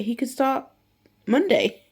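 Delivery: high, loud on Monday; excited promise. Retold or imitated. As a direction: build up to Monday. Teacher promises early start.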